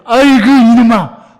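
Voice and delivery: loud, slow sigh of a fed-up father; exasperated groan. Retold or imitated. 하나님이 한탄하며 개입. (답답해서 크게 늘어지는 "아이고")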